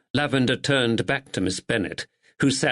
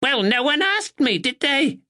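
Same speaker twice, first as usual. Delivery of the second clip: put-on sharp old woman. A narrator's reading. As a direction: snap it out like a sharp sparrow.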